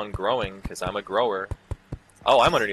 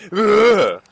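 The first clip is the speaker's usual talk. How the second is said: loud, high mocking roar; zombie roar; 13 semitones higher, 13 dB louder than usual. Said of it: "also goes like".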